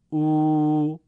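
او held long, loud and level; long vav. Shown as a demonstration. Teacher showing two-beat vav maddi, prolonged. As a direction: stretch او over two beats. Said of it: "دو ضربی".

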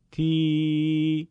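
تی drawn out long. Shown as a demonstration. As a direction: stretch تی into a long ee. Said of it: "با صدای کشیدهٔ ای بخوانید".

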